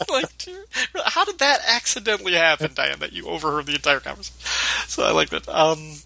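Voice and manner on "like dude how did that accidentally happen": high, laughing mock disbelief; laughing incredulous dude, much higher. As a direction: scoff through laughter at the excuse. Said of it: "mocking Diane's excuse".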